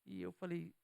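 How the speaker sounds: ordinary talk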